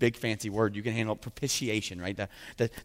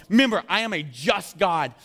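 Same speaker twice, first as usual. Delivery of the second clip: stern God; 8 semitones higher, 7 dB louder than usual. Acted. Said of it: "He's going".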